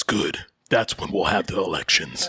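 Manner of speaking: low, sinister villain tone. Retold or imitated. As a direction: drop the voice low and menacing. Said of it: "well, more sinister voice".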